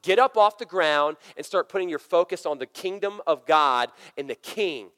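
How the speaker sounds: ordinary talk, nothing marked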